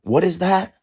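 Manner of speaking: mock surprise. Son eyeing mom's plain dinner, mock surprise. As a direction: act thrown by the plate.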